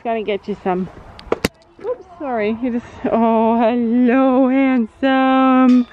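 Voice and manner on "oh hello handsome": louder, sing-song fond hello; cooing to horse. Real, not acted. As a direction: praise him like a sweetheart. Greeting horse affectionately.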